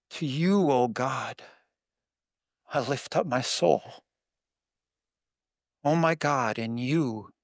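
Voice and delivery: slow, measured psalm recital sinking to a hush; scripture recitation. Acted.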